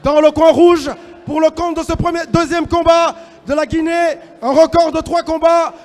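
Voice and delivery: booming, drawn-out fighter introductions; boxing ring announcer. Acted. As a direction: announce each fighter to the crowd.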